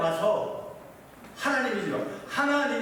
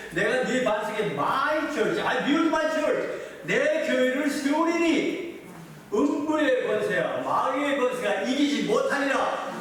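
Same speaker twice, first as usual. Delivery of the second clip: preaching proclamation; 4 semitones higher, slower than usual. Acted. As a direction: declare it like a promise from the pulpit. Proclaiming Jesus's words. (천천히 힘차게 선포하듯)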